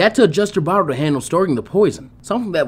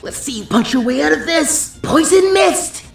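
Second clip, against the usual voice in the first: higher, drawn-out cry of the move name; jutsu battle cry; 11 semitones higher, slower than usual. A narrator's reading. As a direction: hurl the technique name at the enemy. Ino announces her jutsu.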